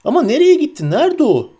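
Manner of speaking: high, loud, frantic questioning; anxious shout. A narrator's reading. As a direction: cry out high, desperate to know. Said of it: "diye bağırdı Pippin". (tiz, telaşlı bağırış)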